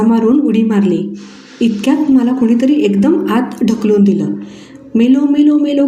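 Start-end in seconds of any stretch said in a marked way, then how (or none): 4.9-5.9 mild panicked yell. A narrator's reading. Boy suddenly pushed into the well, mild panicked yell. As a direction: cry out as if going under, held back. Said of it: "करून मी खूप जोरात ओरडलो".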